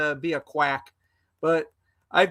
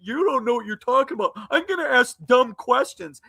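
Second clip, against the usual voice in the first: mocking imitation; 2 semitones higher than usual. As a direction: ham up the troll's whiny voice. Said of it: "He just".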